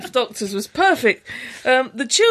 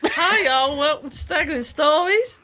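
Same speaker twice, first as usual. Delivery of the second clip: hammed-up American accent, lifting at the end; Perry; 3 semitones higher than usual. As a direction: put on a folksy American accent. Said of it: "be, be Perry".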